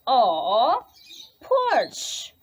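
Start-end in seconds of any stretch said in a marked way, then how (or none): 1.4-2.3 porch? leaps up high; high teasing wrong word. Shown as a demonstration. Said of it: "Which one is it?".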